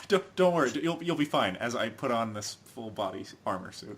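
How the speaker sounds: quiet, dry mock reassurance; sarcastic reassurance. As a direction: reassure them while clearly lying.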